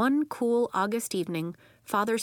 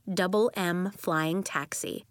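ordinary delivery, nothing marked

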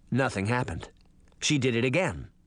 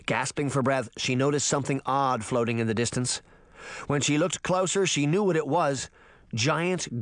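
ordinary delivery, nothing marked

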